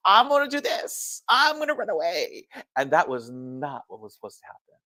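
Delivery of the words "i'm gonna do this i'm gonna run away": put-on higher, childish pleading; a hint of a sulky kid refusing; lightly whiny run-away plea; whiny protest. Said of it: "I kept telling Kami-sama".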